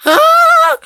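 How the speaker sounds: sharp gasp, then high scream; panicked gasp and scream. Real, not acted. Water rising to her neck.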